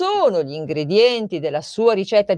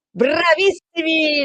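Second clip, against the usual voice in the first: drawn-out cheer; 8 semitones higher, slower than usual. Acted. Host praises the listeners.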